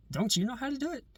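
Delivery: women's retort. Retold or imitated. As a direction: lightly voice the women pushing it back on him.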